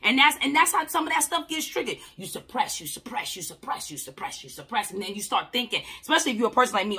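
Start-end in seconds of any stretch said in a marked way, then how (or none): 2.2-4.9 each you suppress softer and lower, sinking into a whisper; fading repetition. Shown as a demonstration. Showing how people push feelings down, fading out. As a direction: let it sink away to nothing. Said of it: "shows suppressing by fading".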